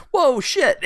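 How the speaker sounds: high squeaky startled whoa, mock surprise. Dancers startled when she turns them down, high mock whoa. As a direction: jump in pitch like caught off guard. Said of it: "and they're like".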